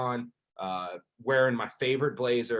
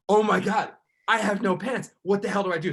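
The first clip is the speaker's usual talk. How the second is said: high, louder frantic cry; panicked; 8 semitones higher, 5 dB louder than usual. Retold or imitated. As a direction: sound like you're freaking out. Panicking with no pants.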